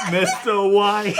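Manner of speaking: loud, laughing, drawn-out call; Jesse Pinkman voice. Acted. Jesse Pinkman shouting at Walt.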